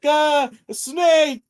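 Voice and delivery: high, loud, drawn-out scream of fear; shrieking scream. Retold or imitated. Zack shrieking at the snake in mock terror. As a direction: shriek it like a terrified kid. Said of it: "he's like".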